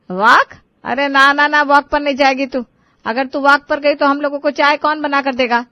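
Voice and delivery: loud outraged refusal; forbidding. Acted. Mother-in-law forbidding the daughter-in-law's walk, loud and outraged.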